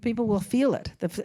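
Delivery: ordinary delivery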